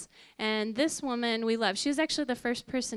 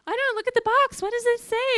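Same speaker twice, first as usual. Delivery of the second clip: much higher, louder, excited; flustered husband; 12 semitones higher, 8 dB louder, faster than usual. Retold or imitated. Husband telling wife to check the test box, much higher and louder. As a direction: rush it, just as shocked as she is.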